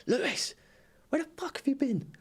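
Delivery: high-pitched mock yell, no real volume; retold shout. Manager calling out the missing player, imitated shout. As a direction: imitate a manager shouting across the training ground. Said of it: "he just shouts across".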